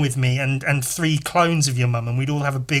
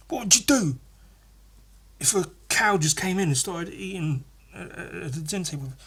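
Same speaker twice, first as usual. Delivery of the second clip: mocking friend; 3 semitones higher than usual. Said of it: "mocking the friend's question".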